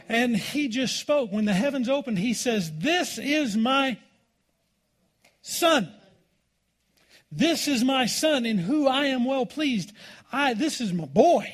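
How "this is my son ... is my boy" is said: booming, proud father's declaration, pitched higher; proud voice of God. Acted. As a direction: announce it like a proud father from heaven. God speaking at baptism, proud.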